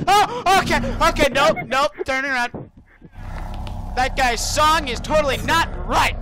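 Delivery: high panicked shouting; scared horror game streamer. Real, not acted. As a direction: freak out at the monster. Monster appears, panic.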